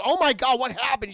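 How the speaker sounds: loud high "Oh my God"; mock shocked gasp. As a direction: react in hammed-up shock. [name] shocked.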